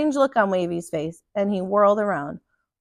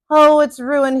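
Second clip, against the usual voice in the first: louder wail that it's ruined; moaning; 4 semitones higher, 7 dB louder, slower than usual. A narrator's reading. Captain moans over his ruined cutlass, louder lament. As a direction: lament the broken sword. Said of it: "he moaned".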